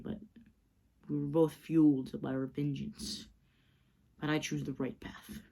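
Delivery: low, soft and steady, a hint of Batman; Bruce's calm speech. Bruce to a broken Slade, low and soft. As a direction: quietly lecture the man you beat.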